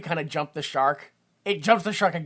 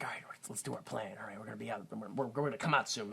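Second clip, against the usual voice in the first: very low, hushed plotting; imitated schemers, barely voiced; conspiratorial whisper; 7 semitones lower, 14 dB quieter, faster than usual. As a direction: whisper the scheme to your accomplice. Voices schemers hatching their plan.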